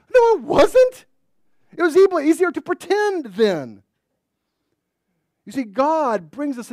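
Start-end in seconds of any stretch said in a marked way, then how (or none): 0.1-3.8 very high, loud no; emphatic rebuttal. Real, not acted. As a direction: cut the excuse off hard. Preacher rebuts quoted excuse.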